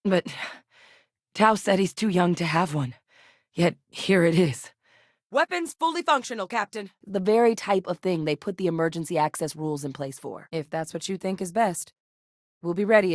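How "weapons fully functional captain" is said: high-pitched report, easing down on Captain; urgent status callout. Acted. Crew member reporting weapons to captain, high urgent callout.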